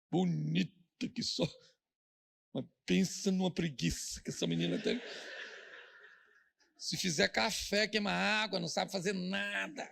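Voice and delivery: lightly voiced old granny, slow; a hint of a gossiping grandmother; old grandma voice. (leve imitação de vovó fofoqueira)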